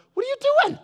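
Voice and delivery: high, loud demanding question; indignant yell. Retold or imitated. Confronting the worker who flattened the kids' track, indignant yell. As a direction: call out in shock at the ruined track.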